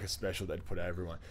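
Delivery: ordinary delivery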